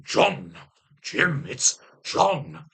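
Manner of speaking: drawn-out names with halting gaps; Spock. Acted. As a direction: call out each name as a slow character impression. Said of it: "I'm meeting Spock. He's going".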